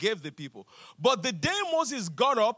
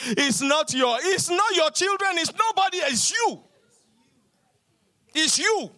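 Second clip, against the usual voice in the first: louder and higher, driving to the point; preaching climax; 6 semitones higher, 4 dB louder than usual. Acted. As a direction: build it to a ringing peak.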